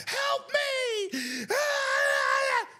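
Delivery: high-pitched panicked squeal, hammed-up; exaggerated falsetto scream, mocking him; mock screaming for help. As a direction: imitate a player shrieking in distress. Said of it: "He's screaming".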